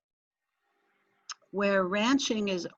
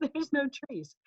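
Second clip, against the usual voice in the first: laughing; 6 semitones higher than usual. Real, not acted. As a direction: laugh through it, pitched up. Repeats the punchline, laughing.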